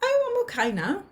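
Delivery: lightly acted relief, high-pitched opening; relieved. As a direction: let a little relief lift the voice. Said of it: "a little bit sense of relief".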